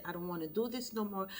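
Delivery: ordinary delivery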